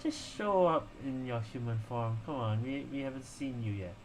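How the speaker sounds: normal talking voice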